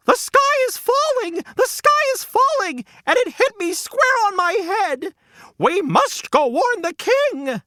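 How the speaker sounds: panicked hen yelling. Acted. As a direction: yell the alarm like a terrified hen. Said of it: "and yelled".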